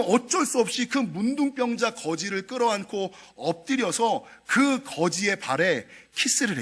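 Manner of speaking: usual speaking voice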